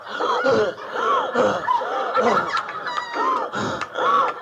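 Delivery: high, gasping wheeze of a smoker; comic out-of-breath gasp; wheezing gasp. Retold or imitated. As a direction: gasp for air after a rally.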